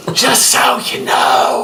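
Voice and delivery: stage whisper. Shown as a demonstration. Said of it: "You do a stage whisper".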